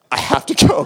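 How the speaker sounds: put-on girlfriend's urgent plea. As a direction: play the girlfriend pressed to leave the tent.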